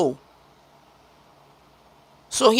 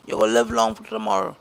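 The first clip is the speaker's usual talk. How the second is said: ordinary talk, nothing marked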